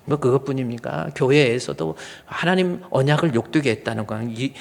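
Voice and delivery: ordinary delivery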